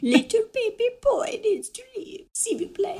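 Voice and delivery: high, quiet mock French accent, condescending; mock French Fleur. As Fleur, dismissing Harry in a high, mocking French accent. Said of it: "We'll work on our French accents".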